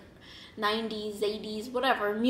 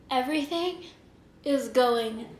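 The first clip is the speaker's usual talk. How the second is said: mock drunken alarm; 2 semitones higher, 5 dB louder, slower than usual. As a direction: imitate a dizzy drunk, pausing between words. Retelling own drunken panic at spinning room.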